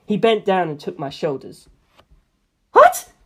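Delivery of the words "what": high, loud squawk; a boy suddenly startled; startled Antonio. A narrator's reading. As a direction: yelp in shock. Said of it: "Antonio said".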